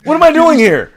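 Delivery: hammed-up lost Biden; mock confused Biden. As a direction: play the lost old man through a laugh. Joining Biden impression.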